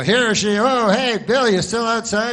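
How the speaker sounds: loud, high, bright anchor voice calling out to the field reporter; hammed-up TV host hailing a reporter outside; mock news anchor.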